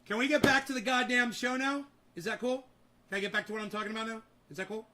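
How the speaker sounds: high, loud and fed up, then fast; pushing back to the show, irritated; exasperated demand. Real, not acted. Host demands to get back to the show.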